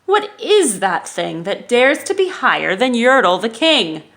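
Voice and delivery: loud, stubborn royal vow; defiant king. Acted. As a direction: refuse to be outdone by the moon. King defying the moon.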